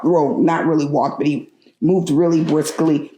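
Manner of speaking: ordinary talk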